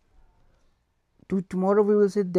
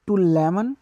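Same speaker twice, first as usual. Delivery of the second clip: usual speaking voice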